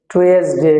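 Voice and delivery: টুয়েসডে as "tu-es-de" with plain t and s; common mispronunciation. Shown as a demonstration. Teacher listing days as we usually say them, common mispronunciation. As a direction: say টুয়েসডে the local way, "tu-es-de". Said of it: "আমরা সাধারণত এভাবেই বলে থাকি".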